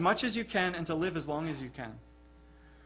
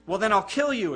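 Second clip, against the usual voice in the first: louder, angry emperor threat; angry exclamation; 3 semitones higher, 8 dB louder than usual. A narrator's reading. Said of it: "exclaimed the emperor angrily".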